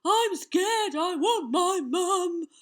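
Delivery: put-on high, loud, drawn-out babyish bawling; sobbing wail. A narrator's reading. Beaten giant cries for his mum, wailing. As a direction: bawl slowly like a frightened baby. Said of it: "the giant sobbed and wailed".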